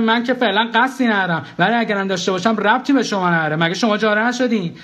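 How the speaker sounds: high, argumentative comeback; indignant retort. Acted. Narrator tells Niloufar's ex-husband to butt out, indignant retort.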